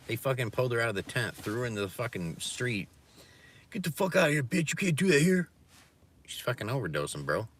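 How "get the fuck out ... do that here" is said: high, loud mock yell; retold yell. Retelling a man evicting a woman from a tent, high loud yell. Guy yelling at overdosing woman.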